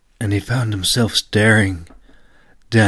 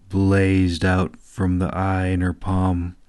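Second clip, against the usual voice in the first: ordinary delivery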